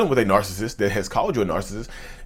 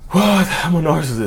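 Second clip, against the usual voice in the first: retold crying outburst, higher pitched; mock boo-hoo crying; 5 semitones higher than usual. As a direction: act out a short tearful wail. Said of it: "boo-hoo crying".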